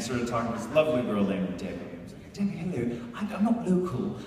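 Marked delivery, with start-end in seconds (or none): 2.3-4.2 put-on soft, polite British accent; quietly courteous British visitor. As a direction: put on a British tourist asking the way. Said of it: "talk to her in this accent".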